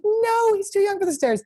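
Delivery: high, insistent mock scold; mock fussy insisting. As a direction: nag like an overprotective owner. Friend quoted insisting again.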